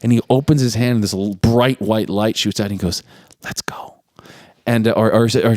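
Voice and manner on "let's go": soft whispered urging; hushed invitation. Retold or imitated. Angel opening his hand to Abby, whispering. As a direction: whisper it like a magical secret.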